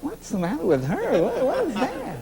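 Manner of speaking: high-pitched comic exasperation, imitated; mock bafflement. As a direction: puzzle aloud over a fussing mother. Mocking his mother's worry, retold.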